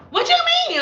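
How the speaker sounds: high, puzzled client voice; mock client. Client questions the stylist, high puzzled voice.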